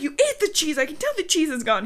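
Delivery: high-pitched, lightly acted accusing; mad accusation. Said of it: "she wants to be mad".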